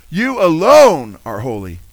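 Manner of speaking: slow and loud, "alone" stretched and swelling; stress on alone. Acted. Preacher repeats verse so listeners catch it, stressing "alone". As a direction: drive home "alone" with a long, loud emphasis.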